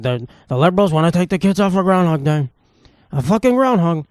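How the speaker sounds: lightly done blustering politician voice; a loose, mocking take on a rally rant; Donald Trump. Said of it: "Donald Trump would make fun of it".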